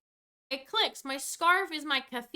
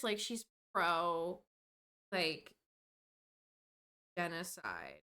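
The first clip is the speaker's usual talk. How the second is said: stunned low trail-off; 9 semitones lower, 6 dB quieter, slower than usual. Real, not acted. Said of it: "disbelief, voice dropping away".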